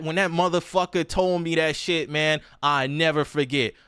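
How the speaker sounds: usual speaking voice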